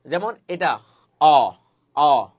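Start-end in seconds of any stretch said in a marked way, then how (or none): 1.2-2.3 অ said open and loud with the jaw dropping; demonstrated vowel. Shown as a demonstration. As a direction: drop the jaw and sound out অ loudly. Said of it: "এটা নিচে নেমে যাচ্ছে". (চোয়াল নামিয়ে জোরে অ উচ্চারণ)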